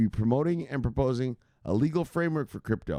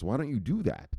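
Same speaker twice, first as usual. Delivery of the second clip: ordinary talk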